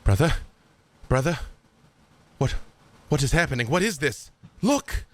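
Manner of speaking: rising, urgent, alarmed calls; alarmed brother. Acted. Armand warns his brother of danger, rising alarm. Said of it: "you hear Armand say to you".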